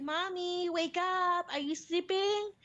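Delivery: put-on high, drawn-up little-kid wake-up call; young daughter. Daughter waking half-asleep mom, high imitated child voice. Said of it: "She's like".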